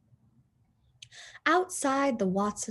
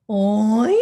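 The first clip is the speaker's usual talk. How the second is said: loud oink with a questioning lift; questioning pig oink; 11 dB louder than usual. Acted. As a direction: squeal it like a curious pig.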